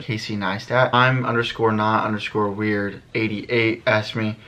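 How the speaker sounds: usual speaking voice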